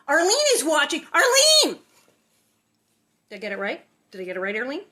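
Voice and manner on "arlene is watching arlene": louder, higher excited call-out; thrilled she's watching; excited shout. Real, not acted. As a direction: shout her name in delight. Excited calling out to Arlene.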